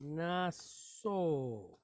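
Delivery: "Nasog" slowly split as "naaah-soog"; drawn-out pronunciation. Shown as a demonstration. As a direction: stretch both syllables of "Nasog", softly. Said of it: "How would we pronounce that".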